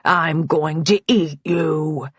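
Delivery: slow toy-monster threat; slow mock-monster voice; playful menace. A narrator's reading. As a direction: menace a child's toy, drawing it out. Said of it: "menacing the Brontosaurus".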